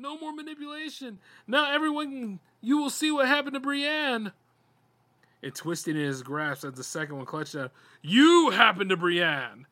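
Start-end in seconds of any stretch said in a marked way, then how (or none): 0.0-4.3 high strained yell to the room. A narrator's reading. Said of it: "He yelled to the room". 8.0-9.7 loud slow accusation. A narrator's reading. Brian accuses the monster.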